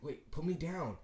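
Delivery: lightly acted cry of protest; mild protest. Sasuke objects as Anko carries him away, mildly protesting.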